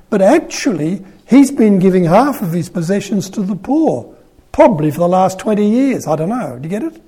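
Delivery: usual speaking voice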